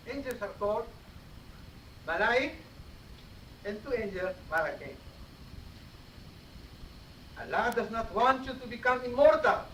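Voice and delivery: normal talking voice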